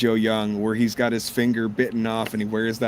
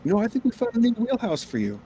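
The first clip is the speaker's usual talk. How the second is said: high fast retold voice; 12 semitones higher, faster than usual. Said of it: "He goes".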